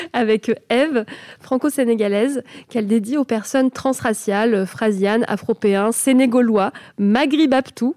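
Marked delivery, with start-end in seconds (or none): none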